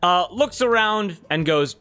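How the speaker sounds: ordinary talk, nothing marked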